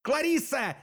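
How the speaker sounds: high-pitched cry of a name; exasperated yell. A narrator's reading. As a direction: cry out as if unable to stand it, pitch way up. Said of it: "завопил я".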